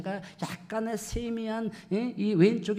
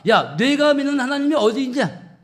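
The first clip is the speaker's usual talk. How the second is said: loud, high jeering challenge; mocking taunt; 7 semitones higher, 8 dB louder than usual. People challenging David about his God, loudly mocking. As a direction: throw it out as a shouted scoff. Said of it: "조롱하고 비아냥거리는 거예요".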